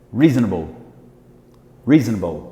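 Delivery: "Reasonable" as REE-zn-bol, low fall; quick "Reasonable" with swallowed middle; American pronunciation. Shown as a demonstration. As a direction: punch the first syllable of "Reasonable". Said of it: "Ele fala reasonable".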